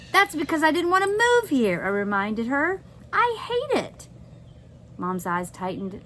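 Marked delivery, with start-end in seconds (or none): 0.1-4.1 high, pushed angry retort. Acted. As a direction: snap back at her mother. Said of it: "snapped to anger".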